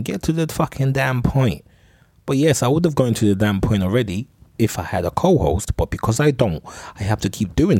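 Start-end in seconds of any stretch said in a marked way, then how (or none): 0.0-1.6 higher, slow exasperated demand; impatient listeners. Retold or imitated. Listeners telling the podcaster to hurry, high and slow. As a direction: voice the bored audience, drawn out and pitched up. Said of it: "I know you lot are thinking".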